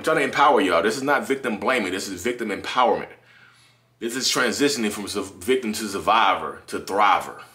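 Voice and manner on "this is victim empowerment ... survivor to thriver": normal talking voice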